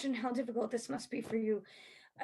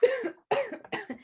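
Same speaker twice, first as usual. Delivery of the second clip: cough; 11 semitones higher, 6 dB louder than usual. Acted. Detective coughing from poison.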